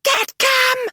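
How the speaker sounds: screamed. A narrator's reading. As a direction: scream across the yard. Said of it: "She screamed to him".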